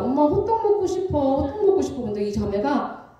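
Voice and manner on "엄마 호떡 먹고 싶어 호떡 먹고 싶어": put-on high-pitched child pleading with mom; little kid wanting a snack; begging child. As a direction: imitate a child's wheedling plea. Voicing her first child begging.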